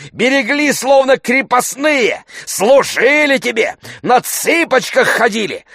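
loud, high, hectoring tirade; angry shouting. A narrator's reading. Tarantyev demanding a promissory note from Oblomov, shouting. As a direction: berate him at the top of your voice. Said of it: "кричите так".

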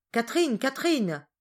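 high, loud cry of a name; calling out. A narrator's reading. Solange calls the servant from the well, crying out. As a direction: call across the yard. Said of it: "alla jusqu'au puits et cria".

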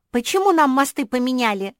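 high, loud indignant cry; outraged little dog. A narrator's reading. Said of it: "возмутилась Куки".

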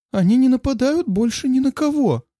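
high, stumbling frightened voice; flustered. A narrator's reading. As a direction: blurt it out in a panic. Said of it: "сбивчиво пытается донести мысль псарь".

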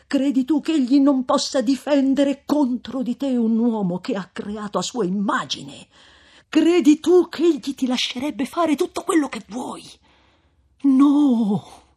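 rising high and loud to NO; thundering rebuke. Acted. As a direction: preach against him. Frate tuona contro la vendetta. (ammonimento tonante, acuto e forte)

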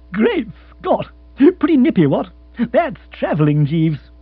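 high, loud astonished outburst; amazed exclamation. Acted. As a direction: gasp out the wonder. Young lord amazed.

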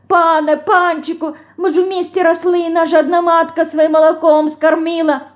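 high, wailing retold plea; tearful pleading. Федорка mimics Павлуха begging the master, tearfully. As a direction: beg like someone on their knees. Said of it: "так слёзно просит".

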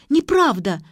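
high, loud outcry; indignant acted shout; shouting protest. A narrator's reading. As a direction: cry out in denial. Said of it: "закричала Ксю".

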